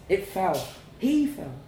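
usual speaking voice

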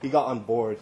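usual speaking voice

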